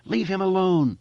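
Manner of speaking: high, loud desperate scream. Acted. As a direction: scream it at the spider. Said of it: "she screamed at the spider".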